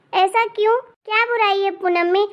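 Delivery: normal talking voice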